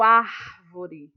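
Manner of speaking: árvore with ÁR loud and drawn out; ÁÁR-vore, first syllable stretched; stress on first syllable. Shown as a demonstration. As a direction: punch and hold the ÁR of árvore, then let -vore drop. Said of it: "a sílaba mais forte vai ser o".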